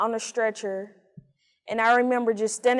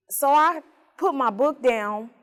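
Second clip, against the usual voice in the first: usual speaking voice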